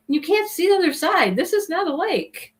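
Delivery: high-pitched mock insistence; imitated disbelief. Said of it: "he said".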